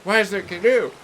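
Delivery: hammed-up baffled boat owner shouting; playfully yelled complaint; mock outraged yell. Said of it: "They're gonna come back and be like".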